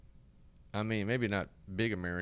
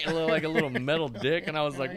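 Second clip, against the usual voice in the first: ordinary talk, nothing marked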